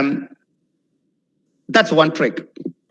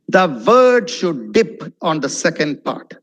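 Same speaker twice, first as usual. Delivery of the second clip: usual speaking voice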